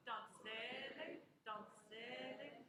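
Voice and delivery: slow "donzelle" with a long, emphasized "ZEL"; second-to-last syllable stressed. Shown as a demonstration. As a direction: stretch the middle syllable of "donzelle". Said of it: "second to last syllable".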